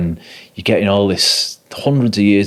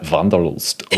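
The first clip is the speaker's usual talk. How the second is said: "Vanderlust" slowly in a leaned-into German accent; exaggerated German accent; slower than usual. Shown as a demonstration. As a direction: really flip into German for "Vanderlust". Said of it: "and really flipping your accent".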